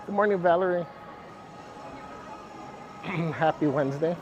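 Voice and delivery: sore-throat muffled voice. Real, not acted. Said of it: "my voice sounds muffled".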